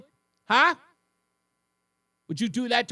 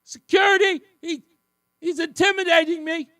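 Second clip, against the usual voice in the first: much higher, loud mocking scream for security; 10 semitones higher, 7 dB louder than usual. Host mocking the guy screaming for security, high loud mock scream. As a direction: shriek for help like a scared kid. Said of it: "screaming for the teacher or security".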